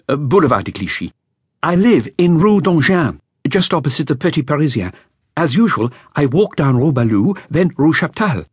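put-on a nervous young witness reporting to police; a hint of an anxious young musician; young flautist. Narrator voices young flautist.

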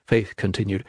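ordinary delivery, nothing marked

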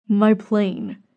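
plain reading voice

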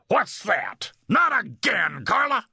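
high, outraged husband's shout; angry roar. A narrator's reading. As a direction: roar at your wife on catching her out. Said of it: "a man's voice roar behind him".

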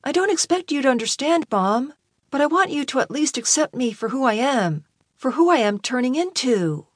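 high, heartfelt voice of Malcolm; earnest pleading son. A narrator's reading. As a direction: plead with a parent to accept you.

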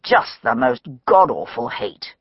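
low, sighing disgust; a heavy sigh at the horror; weary sigh. Acted. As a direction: let it out on a tired breath. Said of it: "Meadows sighed".

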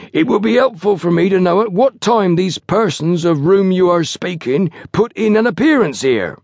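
high-pitched pompous officialdom; lofty. A narrator's reading. Station master questions porters about the police, lofty. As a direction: put on grand, over-formal politeness. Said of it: "he said in a lofty manner".

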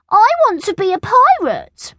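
put-on very high, loud little-girl whine; high, petulant childish demand; grumbling demand. A narrator's reading. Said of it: "grumbled Angela".

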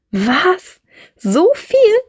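hoch und lauter, fassungslos; verblüfft. A narrator's reading. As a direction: vor Staunen fast aufschreien. Marie staunt über Monatslohn. (Marie, baff über den Verdienst, hoch und laut)

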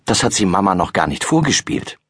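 plain reading voice

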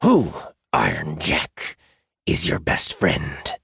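slow, strained question sinking low; seething, drawn-out jackal; through gritted teeth. A narrator's reading. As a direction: force out each phrase with gritted teeth. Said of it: "he repeated through gritted teeth".

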